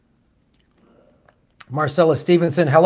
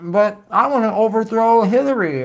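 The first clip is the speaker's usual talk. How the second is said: mock quote; 7 semitones higher than usual. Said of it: "She's all like".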